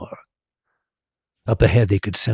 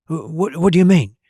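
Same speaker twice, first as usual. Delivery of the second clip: startled; 7 semitones higher, 3 dB louder than usual. A narrator's reading. Ron startled, stammering.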